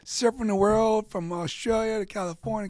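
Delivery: high, loud mock amazement; the friends marvel at Shawnee surfing the world; amazed friends.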